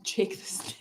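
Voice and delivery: unvoiced whisper on Jake the Snake. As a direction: hiss out Jake the Snake softly. Repeats name whispered.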